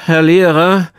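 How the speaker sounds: hoher, gedehnter Ruf nach dem Lehrer; meldender Schüler. A narrator's reading. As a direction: ruf wie ein Schüler, der sich meldet. Pekka meldet sich im Unterricht. (Schülerruf mit angehobener Stimme)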